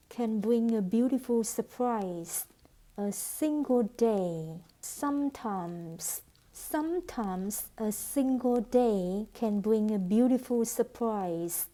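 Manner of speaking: ordinary talk, nothing marked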